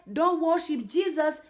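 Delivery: ordinary talk, nothing marked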